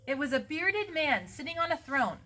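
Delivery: high, emphatic storybook wonder; awed story narration. A narrator's reading. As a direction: marvel aloud at an enormous sight. Awed narration of huge statue.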